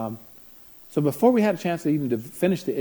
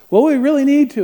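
much higher and louder, pressing hard; urgent insistence; 13 semitones higher, 13 dB louder than usual. Retold or imitated. Counselor on the phone with a panicked mom, urgent. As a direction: insist urgently, almost shouting. Retelling a frantic phone call.

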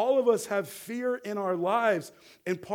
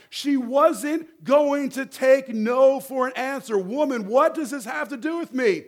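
raised, higher preacher's voice; emphatic preaching; 5 semitones higher, 6 dB louder than usual. Real, not acted. Preacher explains Mary's boldness to the congregation, emphatic preaching. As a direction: drive each point home to the congregation.

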